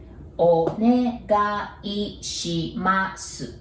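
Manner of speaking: おねがいします said slowly, every mora even; teacher modelling おねがいします slowly; slow careful pronunciation. Shown as a demonstration. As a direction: spell out おねがいします syllable by syllable. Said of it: "And pronunciation".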